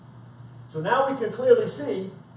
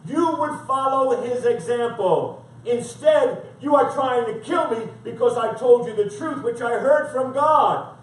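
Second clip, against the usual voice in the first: emphatic scripture reading, pitched up; forceful preaching; 3 semitones higher, 5 dB louder than usual. Acted. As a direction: preach it as a firm rebuke.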